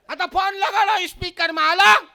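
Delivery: high, mock-furious wife's demand; loud high-pitched challenge; wife's harsh tone. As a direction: order him shrilly, hammed-up. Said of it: "એનો tone જ એટલો ખરાબ".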